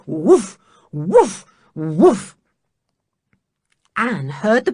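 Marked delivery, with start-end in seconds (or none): 0.0-2.4 loud barking woofs; dog barking. Acted. Timmy to the rescuers, loud barking. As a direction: bark like the dog.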